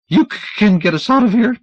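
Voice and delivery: high, breathless stammer; panting. Acted. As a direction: pant it out urgently. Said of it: "Dunlop panted".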